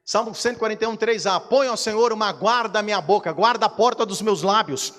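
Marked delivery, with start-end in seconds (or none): 1.4-4.9 loud, high shouted sermon; fiery preaching. Acted. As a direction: proclaim it with full fervour. Preaching at full force. (pregação fervorosa)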